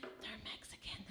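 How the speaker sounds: gossipy whisper; whispered aside. Retold or imitated. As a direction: drop to a secretive whisper. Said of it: "she's always like".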